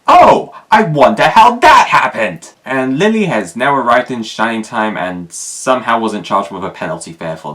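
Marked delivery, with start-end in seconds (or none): 0.1-2.5 loud, high mock-surprised sarcasm; high loud sarcastic surprise; sarcastic exclamation. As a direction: feign amazement at the obvious.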